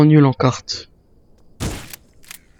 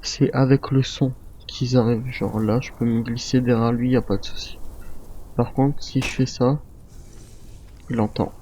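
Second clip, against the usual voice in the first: much softer, held down so the soldier won't hear; hushed sneaking; 2 semitones lower, 9 dB quieter than usual. Real, not acted. Sneaking up behind an enemy soldier.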